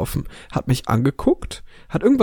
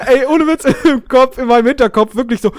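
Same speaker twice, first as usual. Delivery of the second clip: much higher and louder, laughing; excited laughing retelling; 9 semitones higher, 5 dB louder than usual. Real, not acted. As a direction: burst out laughing as you tell it. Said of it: "excited retelling".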